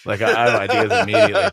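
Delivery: fast and high, laughing through the words. Retold or imitated. Mocking his own eagerness at rehearsal, laughing quickly. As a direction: rush it out, giggling at yourself.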